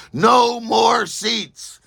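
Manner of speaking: a drawn-out, high, firm no; drawn-out refusal. Retold or imitated. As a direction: stretch out the refusal with finality. Airport woman turning someone away.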